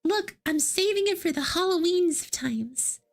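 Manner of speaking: ordinary delivery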